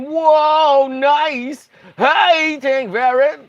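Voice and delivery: high, loud, drawn-out exclamations; excited greeting. Pretend. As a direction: greet them with a big long whoa. Reading chat, drawn-out surfer greetings.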